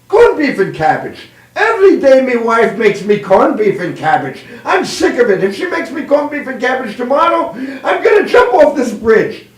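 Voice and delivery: exasperated Irish voice, pitch climbing to a shout; fed-up Irishman. Acted. Irishman grumbling over his lunchbox to co-workers, exasperated Irish accent. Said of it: "the Irishman opens up his lunchbox, says".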